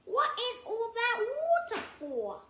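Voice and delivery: squeaky platypus asking. Acted. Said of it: "he said, snapping his bill".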